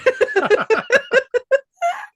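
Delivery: loud, high, rolling real laugh; big laugh. Real, not acted. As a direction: crack up at your own joke. Cracks up at own drinking joke.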